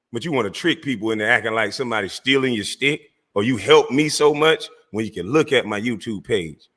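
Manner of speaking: normal talking voice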